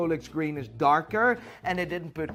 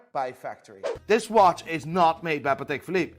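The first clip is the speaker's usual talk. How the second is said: ordinary talk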